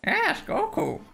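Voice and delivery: put-on very high squeaky old hermit; Master Roshi impression. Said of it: "be your Master Yoshi".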